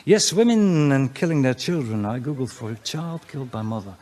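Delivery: normal talking voice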